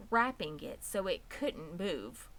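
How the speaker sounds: usual speaking voice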